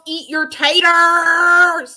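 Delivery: loud, drawn-out imitation yell; yelling fan's catchphrase. Telling the hosts what he yells at games, imitated drawn-out yell. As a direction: holler it out, stretching each word. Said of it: "he also yells".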